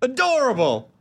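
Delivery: high, laughing squeal of delight; mock gushing. Gushing mockingly over her hometown.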